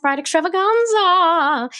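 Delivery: high, stretched, singsong show title; announcing the show title with flair; signature hype delivery. Shown as a demonstration. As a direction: belt the show name like a game-show host. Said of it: "I have to say it that way every time".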